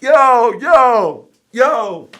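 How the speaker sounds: high, loud repeated hollers; calling out. Retold or imitated. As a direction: holler to get someone's attention from afar. Calling to promoter across the lot.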